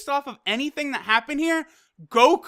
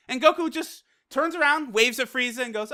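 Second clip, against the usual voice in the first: usual speaking voice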